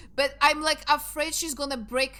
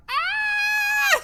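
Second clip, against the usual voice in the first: high, loud cat yowl; cat shout; 11 dB louder than usual. Retold or imitated. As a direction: imitate an angry old cat shouting. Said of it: "you can already hear from behind the door".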